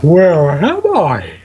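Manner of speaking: slow, stretched-out odd voice; weird voice. Acted. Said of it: "I'll do it in a really weird voice".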